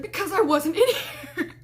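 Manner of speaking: high mock-frightened squeal breaking into laughter; scared insistence. Roommate insisting she was not there, scared squeal. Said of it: "Why do you look so scared".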